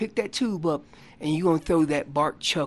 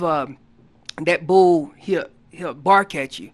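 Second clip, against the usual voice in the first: normal talking voice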